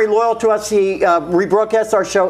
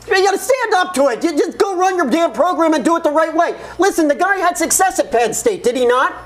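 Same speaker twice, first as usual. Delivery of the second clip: heated, higher and animated; worked-up rant; 7 semitones higher than usual. Real, not acted. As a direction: get fired up arguing. Heated sports debate rant.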